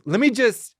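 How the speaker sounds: lightly voiced, eager wife; a hint of her pushy "Let me just"; mock impatient wife. Mocking how she always butts in, lightly voiced. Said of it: "she's always like".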